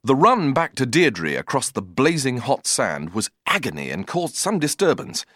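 ordinary delivery

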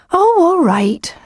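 high, slow, reluctant giving in; exasperated sigh. A narrator's reading. Lucy giving in to the pestering wolf, sighing. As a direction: drag it out, high then dropping. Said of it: "sighed Lucy".

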